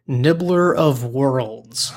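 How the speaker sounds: drawn-out, grand name reading; dramatic slow reading. Real, not acted. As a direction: roll the name out slowly. Host savours dramatic name.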